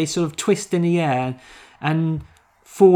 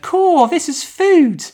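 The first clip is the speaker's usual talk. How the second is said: put-on high, slow and loud boyish British voice, stressing food; squeaky kid, leaning on "food"; misheard Luke line; 6 dB louder, slower than usual. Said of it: "But I heard".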